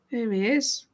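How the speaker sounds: ordinary delivery, nothing marked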